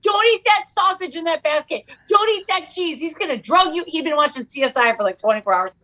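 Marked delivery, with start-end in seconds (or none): 0.0-3.8 higher, urgent mock warning; lightly voiced friend warning her off the food; Bone's warning. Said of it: "He's like".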